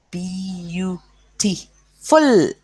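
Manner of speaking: beautiful broken into four beats, "B... U... T... full"; split into syllables. Shown as a demonstration. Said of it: "four syllables".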